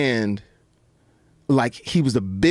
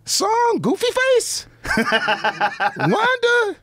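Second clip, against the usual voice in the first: very high falsetto disbelief; startled high-pitched mock protest; incredulous squeal; slower than usual. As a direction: squeak it out like you can't believe it. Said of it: "I was like".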